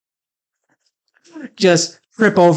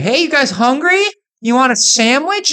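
high, louder fake-friendly call; mocking offer; 10 semitones higher, 3 dB louder than usual. Calling out a fake food offer to riders, high and teasing. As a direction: bait them with food. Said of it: "the idea is literally to mock them".

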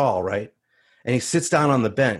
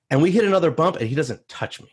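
plain reading voice

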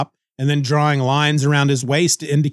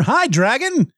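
bright, much higher "Hi, dragon" as a cheerful hello; friendly greeting; 9 semitones higher than usual. Pretend. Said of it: "somebody greeting a dragon in a friendly manner".

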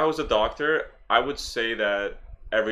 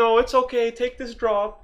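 much higher, soothing fake doctor; high mock doctor voice; 12 semitones higher than usual. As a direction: talk him down like a shady doctor. Imitating the Chinese doctor.

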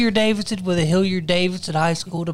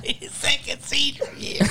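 breathless laughing whisper, barely voiced; 6 semitones higher, 7 dB quieter than usual. Real, not acted. Teasing his friend about repeating senior year, whispered through laughter.

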